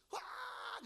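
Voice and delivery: high piercing mock baby cry, very high; imitated baby wail. Preacher imitating his crying baby's high wail. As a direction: imitate the baby's scream at the injection. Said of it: "sampai suaranya hilang".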